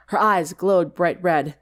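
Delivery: usual speaking voice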